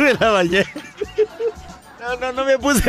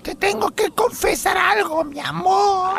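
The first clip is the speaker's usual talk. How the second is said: high, mock-dramatic wife voice; wife confessing; 6 semitones higher than usual. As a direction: play a wife with a big confession. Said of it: "que ella dice". (esposa dramática de voz aguda)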